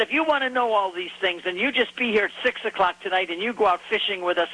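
put-on quoting the fisherman in a Hebrew accent; thick Hebrew accent. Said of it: "in very thick Hebrew accent".

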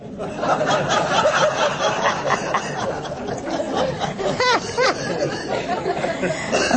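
a long, high, airy laugh. Real, not acted. Still laughing at the nationality joke, long airy laugh. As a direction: let the laugh run on.

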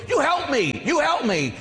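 high-pitched imitated plea for help; crying out. Padre Pio begging his guardian angel, crying out. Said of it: "he's crying out to his guardian angel".

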